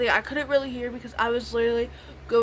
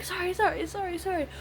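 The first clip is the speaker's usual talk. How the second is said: imitated tearful apology; 7 semitones higher, faster than usual. Friend apologising while being led away, mock tearful. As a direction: mimic an upset girl apologising over and over.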